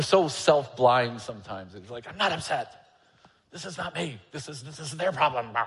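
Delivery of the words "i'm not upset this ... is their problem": defensive denial. Retold or imitated. Mocking own defensiveness.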